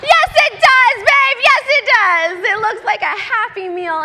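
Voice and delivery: loud, high cheering affirmation; exuberant, celebrating mom; jubilant agreement. Acted. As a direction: shout back in delighted agreement. Mother jubilantly agreeing with son.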